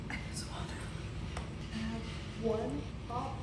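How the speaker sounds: tipsy late-night whisper. Real, not acted. Admitting to viewers she drank soju, whispered. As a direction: breathe it out quietly.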